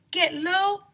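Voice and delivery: high, loud call; shouting. A narrator's reading. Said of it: "shouted Ryan".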